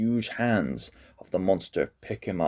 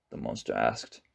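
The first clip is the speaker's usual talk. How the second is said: ordinary delivery, nothing marked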